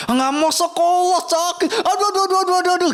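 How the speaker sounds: high-pitched mock wail of pain; imitated whining. Child refusing school over a small prick, mock whining. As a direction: whine like a child making excuses, hammed-up. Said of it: "bilang nggak mau sekolah".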